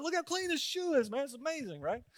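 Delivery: high, delighted exclamation; amazed. Retold or imitated. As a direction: gush over the clean porch like a sucker sold. Showing wife the scrubbed shoe.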